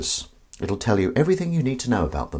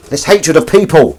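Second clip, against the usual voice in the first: suddenly loud and high; raised, emphatic mock fury; mock hateful outburst; 6 semitones higher, 8 dB louder than usual. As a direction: burst out with exaggerated contempt. Mock outburst repeating the phrase louder.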